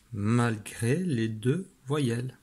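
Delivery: normal talking voice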